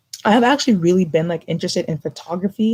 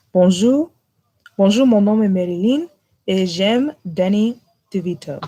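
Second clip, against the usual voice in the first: French, accent shifted; 2 semitones higher than usual. Shown as a demonstration. Retrying the French for friends, accent shifted. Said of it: "my accent kinda shifted".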